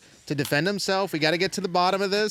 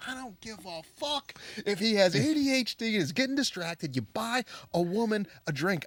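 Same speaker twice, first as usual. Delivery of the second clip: higher mock outraged woman's voice; imitating an indignant woman; 6 semitones higher, 4 dB quieter than usual. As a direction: impersonate her ranting about the drink. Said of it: "She goes".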